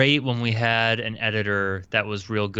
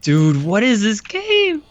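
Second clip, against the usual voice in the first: surprised; 12 semitones higher, 8 dB louder, slower than usual. Real, not acted. Reacting to a strange game title.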